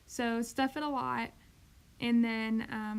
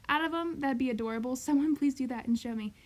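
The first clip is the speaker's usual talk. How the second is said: ordinary delivery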